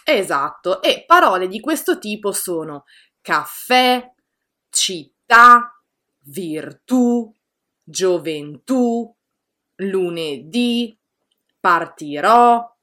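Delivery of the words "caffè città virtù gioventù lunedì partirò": each word said slowly with the voice rising on the last syllable; slow teacher demo of final-syllable stress; final stress, rising at the end. Shown as a demonstration. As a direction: let the tone climb on the final vowel. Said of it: "il tono sale alla fine della parola".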